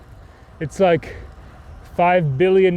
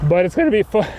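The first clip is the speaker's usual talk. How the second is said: voice crack; 4 semitones higher, faster than usual. Real, not acted. Cyclist reassuring viewers about the downhill, voice cracking. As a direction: voice cracking upward mid-sentence. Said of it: "Nice voice crack".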